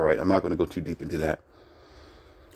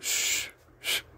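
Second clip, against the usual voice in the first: soft whispered hushing; secretive whisper; whispered shush; 9 dB quieter than usual. Retold or imitated. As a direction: hush it under your breath. Hushing so girlfriend won't wake.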